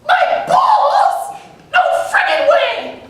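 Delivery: falsetto outcry, high and loud with a laugh; high-voiced man hears he must be castrated; high-voiced panic. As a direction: squeal it in falsetto. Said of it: "he has this unnaturally high voice".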